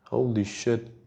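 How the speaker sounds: usual speaking voice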